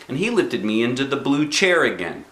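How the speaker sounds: ordinary delivery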